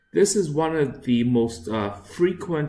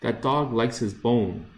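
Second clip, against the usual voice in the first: ordinary talk, nothing marked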